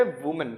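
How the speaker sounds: "वुमन" with a "wu" first syllable; singular pronunciation. Shown as a demonstration. Teacher introducing the singular word, careful model pronunciation. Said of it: "और singular होता है".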